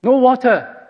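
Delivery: high, loud mocking cry of no water; grumbling Israelite griping at Moses; sarcastic complaint. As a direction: throw "No water." out as a bitter jab. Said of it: "sarcastic and aggressive way".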